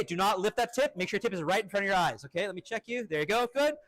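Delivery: normal talking voice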